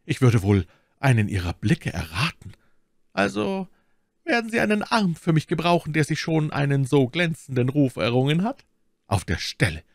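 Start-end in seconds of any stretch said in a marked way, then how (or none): 3.1-8.6 high-pitched lady's voice; Milady courting a champion. Acted. Milady asks D'Artagnan to fight for her, high-pitched coaxing. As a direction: play a flattering noblewoman, voice lifted high.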